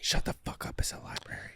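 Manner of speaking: unvoiced angry whisper; librarian shushing whisper. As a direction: whisper it with a harsh edge. Mock rude librarian whisper.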